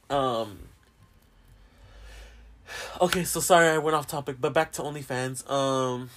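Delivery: ordinary delivery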